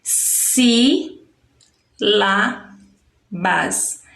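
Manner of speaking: "si", "lá", "bas" as three separate sounds; each of "si", "lá", "bas" held alone, silence between; syllable by syllable. Shown as a demonstration. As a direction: break "si, lá, bas" apart with long pauses. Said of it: "ela sai em três sons".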